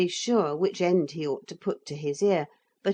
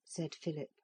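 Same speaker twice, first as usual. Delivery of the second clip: usual speaking voice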